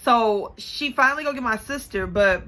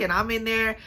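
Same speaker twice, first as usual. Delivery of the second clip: normal talking voice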